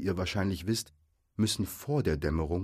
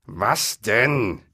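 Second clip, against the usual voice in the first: louder troll question; lightly voiced troll, raised voice; troll calling back; 3 semitones higher, 8 dB louder than usual. A narrator's reading. Bert answering Bill's shout, loud question.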